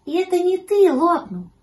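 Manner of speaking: high, loud explaining kitten; high-pitched kitten voice; Гав. A narrator's reading. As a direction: explain as a piping little kitten. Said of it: "объяснил щенку котёнок Гав".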